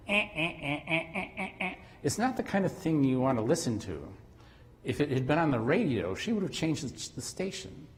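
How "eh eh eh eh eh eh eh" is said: higher, buzzy electronic eh sounds; tinny speaker beeps. Retold or imitated. Cheap computer speaker playing the tune, imitated tinny beeps. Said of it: "little eight ohm speaker on a computer going".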